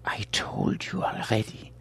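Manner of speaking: frightened whispered stammer. Acted. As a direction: plead in a scared whisper. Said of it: "the curator stammered".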